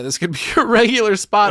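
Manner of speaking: high, loud, laughing; laughing disbelief. Retold or imitated. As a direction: burst out laughing mid-line. Retelling the host's offer.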